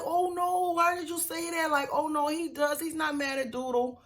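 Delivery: mother hushing her son's remark; flustered mom. Retold or imitated. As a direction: squeak out a flustered denial. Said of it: "She's like".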